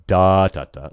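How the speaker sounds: "da, da, da" as one long, loud beat and two short; long-short-short rhythm on "da, da, da"; dactyl beat. Shown as a demonstration. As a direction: hold the first "da", clip the next two. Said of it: "six groupings of dactyls".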